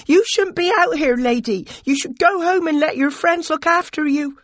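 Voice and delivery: high, loud, public scolding; insistent, carrying appeal. A narrator's reading. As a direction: raise your voice so the square hears. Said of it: "and then louder".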